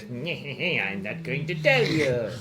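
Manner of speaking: slow robotic computer voice; flat machine voice. Shown as a demonstration. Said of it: "that's my computer voice".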